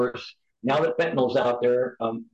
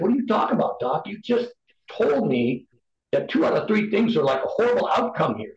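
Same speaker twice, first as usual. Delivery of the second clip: higher-pitched, exasperated retelling; indignant retort; 7 semitones higher than usual. Retold or imitated. Patient retelling the protest to the doctor, indignant. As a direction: protest in disbelief, pitch jumping up. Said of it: "I wanna punch him in the mouth".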